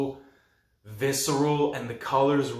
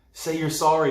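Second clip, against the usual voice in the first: higher, louder retold demand; demanding apology; 4 semitones higher, 6 dB louder than usual. Kids to the beaten monster, insistent demand. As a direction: imitate a kid insisting.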